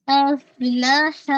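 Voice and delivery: slow افلاح with a breathy whisper of air on the ف and the ح; tajweed demo. Shown as a demonstration. Teacher models افلاح for her students, slow and breathy. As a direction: recite افلاح slowly, letting the air out on ف and ح. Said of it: "ہمس ہوتا ہے".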